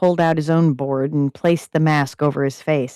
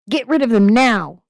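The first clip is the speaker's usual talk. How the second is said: raised yelling order; 7 semitones higher, 4 dB louder than usual. Acted. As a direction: bark the order. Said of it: "the parasite yelled".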